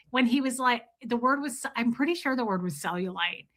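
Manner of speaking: ordinary talk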